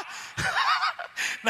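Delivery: high, bursting laugh at own joke; amused preacher laughing high. Real, not acted.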